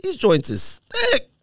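high, slow drawn-out admiration; high drawn-out "thick"; impressed. Real, not acted. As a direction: stretch it out, wowed by the glasses. Admiring new sunglasses.

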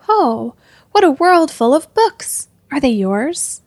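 excited cry. A narrator's reading. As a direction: cry out like a delighted boy. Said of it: "when Lionel cried out".

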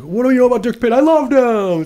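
high, loud mocking voice; mocking the wife. A wife gushing about a dead man, mocked in a high, loud voice. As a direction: parody her as a gushing, overdramatic wife. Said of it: "and she's like".

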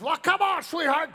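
high, loud retold huff; angry heckler. Offended man calling his wife to leave, angry yell. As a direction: storm off loudly, calling the wife along. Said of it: "screams at me".

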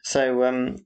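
normal talking voice

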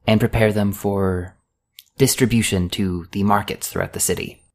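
put-on high, bright young woman's voice, friendly and a little awkward; Lucy, cheerful dock girl. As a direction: play the shy, chatty dock worker.

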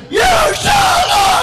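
huge high drawn-out roar; full-force bellow. Acted. Sleepless man facing the mosquito, screamed mock-heroic defiance. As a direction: scream each word out, stretching the last.

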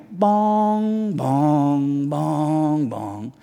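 sung "bong" stepping down in pitch; imitated piano notes. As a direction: intone slow, ominous piano notes. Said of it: "I hear this from the piano".

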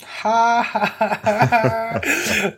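high, separated "ha" laughs; put-on, high-pitched ha-ha-ha; mock laugh. Co-host reacting to who does the synopsis, high mock laugh.

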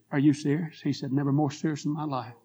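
ordinary talk, nothing marked

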